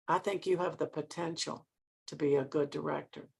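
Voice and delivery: quiet, pointed repetition. Retold or imitated. Mentor repeating her verdict to director, quiet and pointed. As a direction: drop right down to let it sink in.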